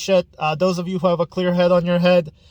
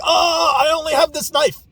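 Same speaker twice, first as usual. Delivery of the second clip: mock panicked yell, much higher and louder; panicked cry; 11 semitones higher, 4 dB louder than usual. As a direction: shout like you're facing four spinning swords with just a knife. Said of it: "and you're like".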